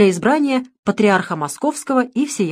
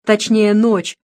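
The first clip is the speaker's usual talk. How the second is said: usual speaking voice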